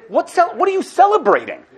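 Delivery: high, slowed questioning; lightly voiced scoffing Pharaoh; incredulous Pharaoh. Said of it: "he looked at Moshe and he said".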